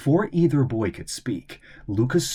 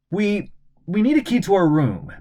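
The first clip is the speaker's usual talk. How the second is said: stammering; 10 semitones higher, 5 dB louder than usual. Acted. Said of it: "stammered Astrid".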